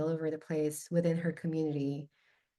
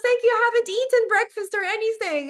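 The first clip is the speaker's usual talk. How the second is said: put-on high, loud, gushing child voice; overjoyed child thanking grandma; excited kid thanking; 7 dB louder than usual. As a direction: squeal thanks like a delighted little kid.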